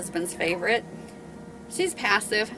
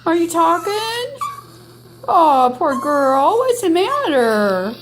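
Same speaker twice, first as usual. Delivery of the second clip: much higher and louder, fond cooing to the dog; cooing pet talk; 9 semitones higher, 7 dB louder, slower than usual. Real, not acted. Owner coos at crying dog.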